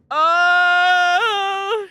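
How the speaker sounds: loud startled oh. Real, not acted. Gamer notices the door opened, startled. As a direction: jolt at the open door.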